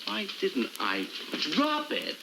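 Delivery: slow start, then a high jump on "drop"; indignant, drawn-out echo of a question; incredulous echo. Acted. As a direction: throw the question back in disbelief. Throws the question back, indignant.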